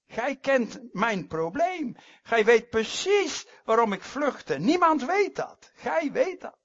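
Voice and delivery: loud, high-pitched cry to God; impassioned plea. Acted. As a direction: call out insistently as Jonah praying. Preacher acts Jonah addressing God.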